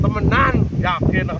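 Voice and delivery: loud emphatic call over wind; shouting over wind. Real, not acted. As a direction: raise your voice against gusts. Strong wind, struggling outdoors.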